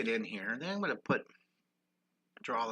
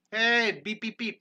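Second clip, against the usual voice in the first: high and loud, cheering the chat on; excited call; 9 semitones higher, 8 dB louder, slower than usual. Real, not acted. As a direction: shout out the beeps happily. Viewers typing beep in chat.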